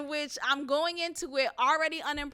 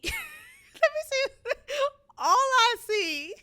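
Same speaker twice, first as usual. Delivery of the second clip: words pushed out between real laughs; can barely get it out for laughing; laughing through it; 9 semitones higher, 3 dB louder, slower than usual. Real, not acted. Laughing in disbelief while describing the video call.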